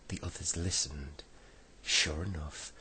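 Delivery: plain reading voice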